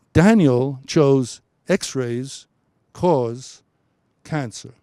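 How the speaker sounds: "X-rays" loud and slow, then "cause", then "cancer"; emphatic pauses. Shown as a demonstration. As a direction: hit "X-rays" hard and let it hang. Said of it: "which is a brilliant choice".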